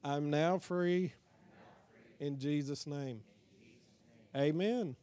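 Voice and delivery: short phrases with long pauses for the congregation; repeat-after-me prayer. Shown as a demonstration. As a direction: lead the room through a prayer, phrase by phrase.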